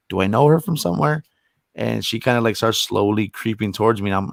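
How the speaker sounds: ordinary talk, nothing marked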